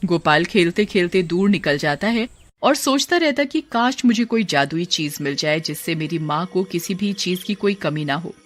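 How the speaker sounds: ordinary delivery, nothing marked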